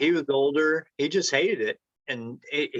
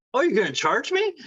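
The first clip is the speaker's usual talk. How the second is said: high, incredulous boss voice; mock surprise; 10 semitones higher than usual. Old boss startled that he'll be charged, high mock surprise. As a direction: play the boss caught off guard by the price.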